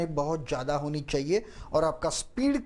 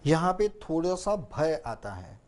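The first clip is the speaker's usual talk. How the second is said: normal talking voice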